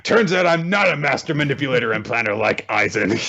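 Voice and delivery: loud, high, hammed-up dismay; mock caught schemer. Schemer admitting he is no master manipulator, mock dismay. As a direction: ham up a villain realizing he has blundered.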